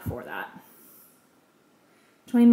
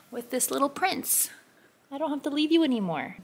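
pet talk; 5 semitones higher, 3 dB quieter than usual. Real, not acted. Cooing to her dog.

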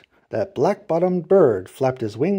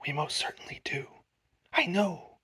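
soft whispered assurance; hushed little reply; faint tiny voice; 2 semitones higher, 8 dB quieter than usual. A narrator's reading. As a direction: whisper back like someone minuscule. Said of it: "The voice was so faint".

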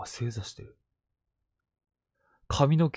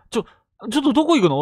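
high, loud startled call; calling after a new hire who walks away, loud and startled; 11 semitones higher, 8 dB louder, much faster than usual. Retold or imitated. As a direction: call after someone walking off. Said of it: "って声かけても".

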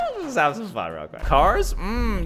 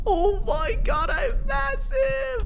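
much higher, slow, stretched-out shout of awe; stunned shout; slower than usual. Real, not acted. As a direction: yell it up high, drawn out in disbelief. Baby grows huge.

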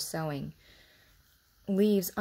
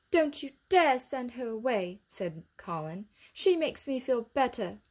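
put-on bossy boy; 6 semitones higher than usual. A narrator's reading. Colin defies Medlock.

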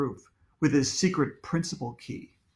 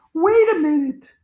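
high-pitched, louder objecting schoolgirl; indignant girl; 12 semitones higher, 6 dB louder than usual. A narrator's reading. Andrea interrupts the principal, high and indignant. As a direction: cut in with a high girl's voice.

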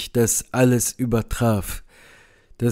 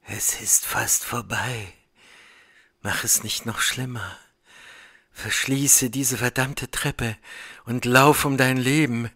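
faint, soft, failing voice; weak voice; 7 dB quieter than usual. A narrator's reading. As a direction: speak as if your strength is nearly gone. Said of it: "die schwache Stimme von Warren". (schwache, leise, ersterbende Stimme)

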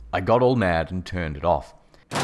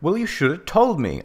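high-pitched retort; indignant retort; 11 semitones higher, 3 dB louder than usual. A narrator's reading. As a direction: fire back, pitched well up. Retort in acted quarrel.

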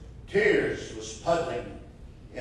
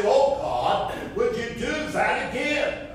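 crying out to God; 9 semitones higher than usual. Acted.

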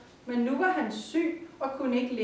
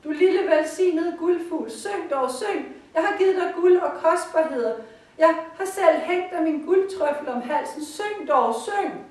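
high, loud begging; dying emperor begging his golden bird, high and loud; desperate pleading; 7 semitones higher, 6 dB louder than usual. Acted. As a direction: beg the bird frantically to sing.

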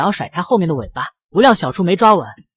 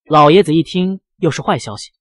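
usual speaking voice

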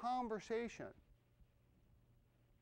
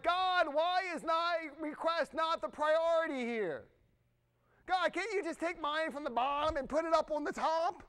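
high, loud plea to God; complaining prayer; 4 semitones higher, 4 dB louder than usual. Acted. Congregant asking God why their request waits, complaining. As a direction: whine to God about being passed over.

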